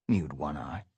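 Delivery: ordinary delivery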